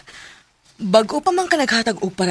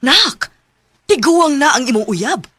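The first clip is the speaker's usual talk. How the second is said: upset scolding; 7 semitones higher, 5 dB louder than usual. Acted. Mother objects to daughter's old boyfriend.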